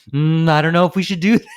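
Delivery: hammed-up second thoughts after a pause; hesitant quote. She hesitates before agreeing to the plan. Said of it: "But first she paused, like".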